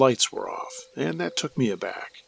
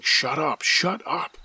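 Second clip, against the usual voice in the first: retold attacker's command; 5 semitones higher, slower than usual. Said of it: "the voice just kept saying".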